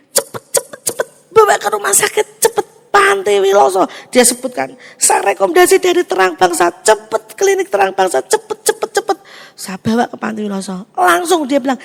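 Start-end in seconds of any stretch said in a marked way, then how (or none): 0.1-3.9 high, pressing hurry hurry; urgent doctor. Retold or imitated. A doctor urging a mother to the hospital, urgent and high. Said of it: "dokter ini ndak pernah ngomong sedemikian rupa". 5.0-9.2 high, insistent repeated cepet; urgent doctor. Retold or imitated. A clinic doctor sending the mother to hospital, urgent and high. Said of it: "dokter ini ndak pernah ngomong sedemikian rupa".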